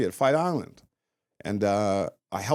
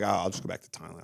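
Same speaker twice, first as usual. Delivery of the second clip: defeated mumble; 6 semitones lower, 5 dB quieter than usual. Retold or imitated. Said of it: "when you're feeling down".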